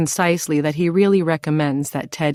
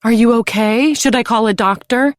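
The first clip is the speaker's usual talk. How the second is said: higher, louder worried mother voice; fussing mother; 6 semitones higher, 4 dB louder than usual. A narrator's reading. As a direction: fuss over him like a mother. Mother character voice.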